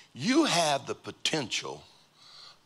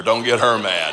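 mock fearful plea, now louder; a bigger, louder take of the warning; louder retake; 7 dB louder than usual. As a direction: say it again louder, with more punch. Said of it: "That felt better right there".

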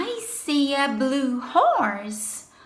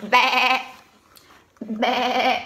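sheep bleat; 5 semitones lower than usual. Pretend. Sheep sound in picture book.

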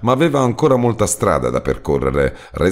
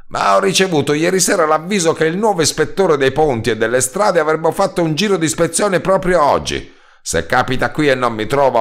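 much higher, anxious worker voice; worried roadman; 7 semitones higher than usual. A narrator's reading. Roadman fretting to the narrator about the inspection, higher.

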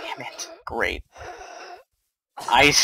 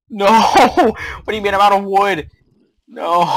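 loud, high, fast wail with laughing; panicked disbelief; 7 semitones higher, 10 dB louder, faster than usual. Real, not acted. Player finding they're out of wood, panicked. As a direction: cry out in dismay, laughing helplessly.

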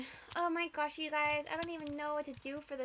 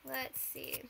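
whispered; 7 dB quieter than usual. Real, not acted. Catching her breath mid-build.

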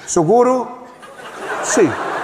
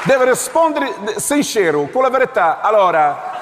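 insistent officer; 3 semitones higher than usual. Acted.

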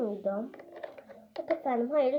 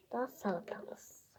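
whispering; 3 semitones lower, 14 dB quieter than usual. Real, not acted.

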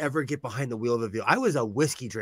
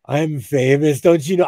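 louder, hammed-up celebrity indignation; mock diva boast; 8 dB louder than usual. Joking about arrested stars to the co-host, mock diva boast. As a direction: play the entitled star for a laugh.

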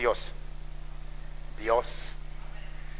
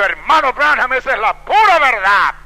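raised, loud exclamation; emphatic affirmation; 6 semitones higher, 7 dB louder than usual. Retold or imitated. Woman affirming the truth to Brother Branham, emphatic exclamation. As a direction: declare it with full conviction.

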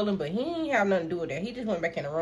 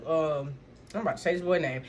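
ordinary delivery

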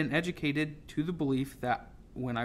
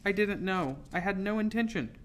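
higher, faltering apology; mildly frightened, halting excuse; 6 semitones higher than usual. A narrator's reading. Girl excusing herself to silent captain, faltering. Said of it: "I faltered".

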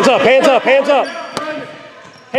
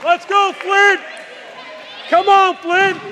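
cheering; 6 semitones higher than usual. Real, not acted. Cheering from the sideline.

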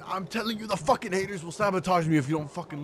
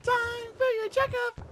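much higher, slow sing-song doctor; creepy doctor voice; 3 dB louder than usual. Pretend. High creepy doctor take.

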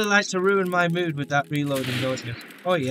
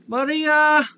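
higher, louder calling out for Maria; 9 semitones higher, 5 dB louder, slower than usual. Pretend. Irish character calls out to find Maria. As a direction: shout to find her.

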